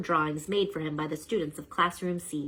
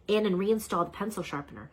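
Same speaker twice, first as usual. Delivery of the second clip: usual speaking voice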